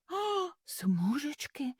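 soft, slow, high dawning fear; a quiet gasp of understanding; hushed realisation. Acted. Mother hare realises, soft and slow.

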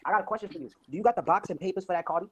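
ordinary talk

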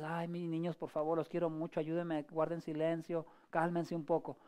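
put-on soft, coaxing request to the children; gentle parental plea. Parent to noisy children asking for silence, gentle plea. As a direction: plead softly and patiently with the kids.

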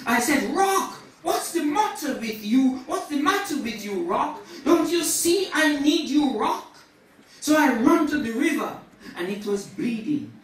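high, forceful storytelling from the pulpit; impassioned preaching. Acted. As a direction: act out the sinner's flight with fervour.

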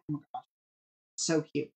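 soft, low gushing; overwhelmed by cuteness. Real, not acted. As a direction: coo softly over it. Gushing over dog dress.

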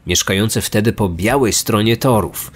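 plain reading voice

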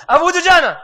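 high, loud, fast mock version of the insert; mocking his clip. He mocks how his recorded clip stands out in the video, high and loud. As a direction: make the spliced name jump out. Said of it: "I heard how I said it".

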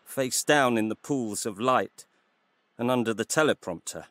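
ordinary delivery

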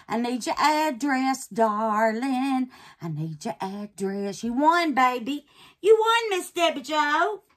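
big, drawn-out joyful call of a name; excited winner announcement. Real, not acted. Host tells Miss Debbie Jo she won, excited and loud. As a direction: call out the winner with real glee.

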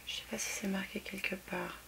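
unvoiced whisper to herself, half under the breath; whispered aside. Real, not acted. Vlogger squinting at a nail polish bottle, whispering to herself. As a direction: breathe it to yourself while hunting for the label. Muttering to herself while searching.

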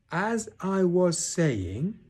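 high, loud, very drawn-out words; loud and stern. A narrator's reading. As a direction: stretch each word sternly over the interruption. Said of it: "said Eeyore loudly and sternly".

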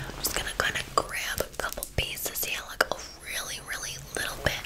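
soft, breath-only whisper; ASMR whisper. Acted. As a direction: whisper it close to the client. Whispered ASMR salon roleplay.

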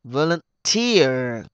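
very slow Volunteer, long TEER; stress on third syllable. Shown as a demonstration. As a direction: say Volunteer as vo-lun-TEEEER. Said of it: "nhấn vần thứ ba lần". (nhấn, kéo dài âm ba Volunteer)

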